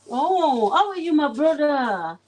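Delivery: ordinary delivery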